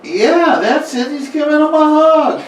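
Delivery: high, delighted squeal of agreement; excited agreement. Real, not acted. Game master loving the player's hug idea, excited. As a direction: jump on the idea with glee. Player suggests monster hugs boy.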